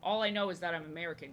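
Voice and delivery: ordinary talk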